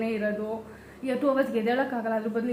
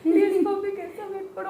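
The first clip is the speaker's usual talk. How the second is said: mock crying plea; 9 semitones higher, 5 dB louder than usual. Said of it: "full ಅಳ್ಕೊಂಡು".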